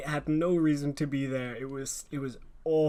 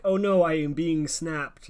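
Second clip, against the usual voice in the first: slow, louder, deliberately wooden acting; mock dismay; 3 semitones higher, 7 dB louder, slower than usual. Actress reacting to being snapped, slow mock dismay. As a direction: announce your doom with hammed-up slowness.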